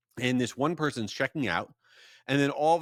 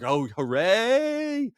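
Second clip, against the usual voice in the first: sarcastic relief; 9 semitones higher, 3 dB louder, much slower than usual. Retold or imitated. Angry man's friend returns.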